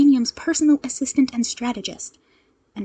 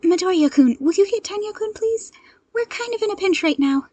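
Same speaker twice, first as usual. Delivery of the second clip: raised, pressing voice over the radio; urgent hero call; 9 semitones higher, 6 dB louder than usual. Acted. Ochako radioing the agency for backup, urgent.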